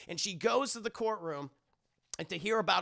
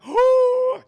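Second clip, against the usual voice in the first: loud, high exaggerated gasp; shocked gasp; 15 dB louder than usual. Wife hearing the abortion suggestion, loud mock-horrified gasp. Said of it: "She was very opposed to that".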